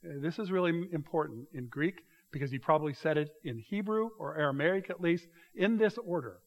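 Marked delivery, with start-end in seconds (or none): none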